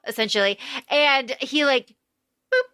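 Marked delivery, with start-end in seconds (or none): none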